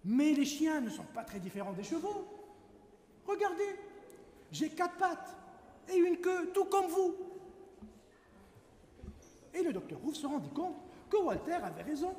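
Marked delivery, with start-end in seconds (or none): 3.3-7.1 higher, eager pleading; pleading wolf. Acted. Wolf arguing to the doctor, higher and eager. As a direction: press the point, pitched up and keen.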